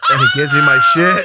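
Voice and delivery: laughter breaking the words up; laughing. Real, not acted. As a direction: say it through a fit of laughter. Laughing through the story.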